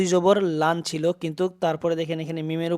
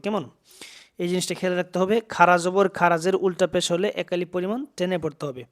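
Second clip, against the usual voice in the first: ordinary talk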